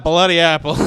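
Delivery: usual speaking voice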